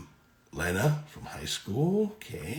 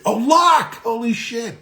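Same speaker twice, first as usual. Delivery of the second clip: delighted surprise; 8 dB louder, slower than usual. Real, not acted. Streamer spots an old friend in chat, loud delighted surprise. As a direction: burst out in glad surprise.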